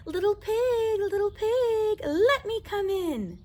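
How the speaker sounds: a sly coyote sweet-talking the pig; sweet coyote call. Acted. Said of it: "He called out sweetly".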